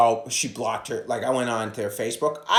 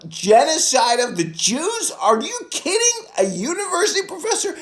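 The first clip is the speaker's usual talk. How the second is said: mock yelling; 5 dB louder than usual. Caller grilling the receptionist, high incredulous yell. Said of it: "I was just yelling".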